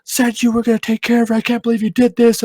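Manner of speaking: high, bitter accusing voice; blaming. Retold or imitated. Wife blaming her husband for the lost baby, high and bitter. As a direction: throw the blame at him, shrill with grief. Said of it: "blaming me".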